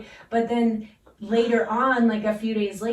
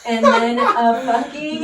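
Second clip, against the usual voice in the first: laughing; 4 semitones higher, 8 dB louder than usual. Real, not acted. Laughs mid-story.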